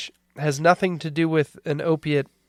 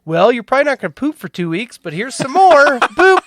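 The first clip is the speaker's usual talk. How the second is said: quick mock-doctor, climbing higher and louder to a squeaky boop; breezy doctor; 7 semitones higher, 6 dB louder, faster than usual. Doctor handing the patient more morphine, breezy imitation. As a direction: imitate a blithe doctor, pitch rising to the end.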